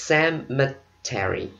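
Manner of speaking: ordinary delivery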